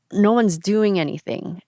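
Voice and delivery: ordinary talk, nothing marked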